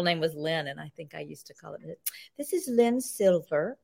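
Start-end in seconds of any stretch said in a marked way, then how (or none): 2.4-3.8 put-on a lightly done British accent, slow and whispered; mock British accent. Calling casting to pose as a British actress, a mock British accent. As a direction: put on a British accent, badly, with a Southern tint. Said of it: "I would put on a British accent".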